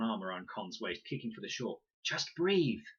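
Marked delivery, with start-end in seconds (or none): 2.0-2.8 high, loud insistent order; urgent coaching. A narrator's reading. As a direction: push her to breathe. Leela calming drowning Con.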